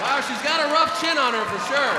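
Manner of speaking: loud, high shouted jab; comic heckling a woman in the crowd, loud shout. Real, not acted.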